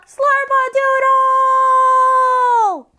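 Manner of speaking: high, long sustained holler; drawn-out, high-pitched shout; yelled outside voice. Shown as a demonstration. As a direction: yell it out, stretching the final sound. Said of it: "Did I just hear you yell".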